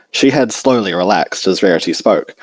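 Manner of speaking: usual speaking voice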